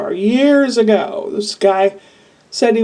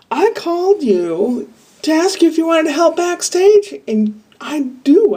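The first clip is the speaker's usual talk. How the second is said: mock lady's invitation; 8 semitones higher than usual.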